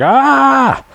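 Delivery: high yelp of pain; imitating Rambo's cry as trees hit him; mock pained yelp. Rambo cries out falling through trees, high yelp. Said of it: "He's like".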